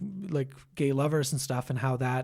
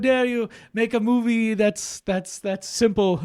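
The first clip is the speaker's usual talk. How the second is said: haughty snob; 6 semitones higher, 5 dB louder, slower than usual. Retold or imitated. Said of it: "You know, like".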